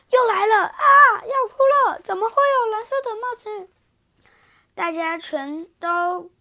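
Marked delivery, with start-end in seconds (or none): 0.1-3.7 high, loud panicked voice; alarmed animal. Acted. Little elephant panicking at the party, high squeal. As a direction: squeal it in dread.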